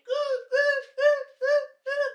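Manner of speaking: very high, loud ape hoots; monkey noises. Pretend. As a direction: hoot like a riding monkey. Imitating monkey ride sound.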